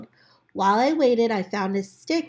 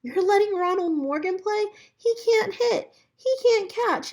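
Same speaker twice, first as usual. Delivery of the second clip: put-on scornful kid; 10 semitones higher than usual. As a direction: sneer it like a know-it-all kid. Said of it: "And Tom said".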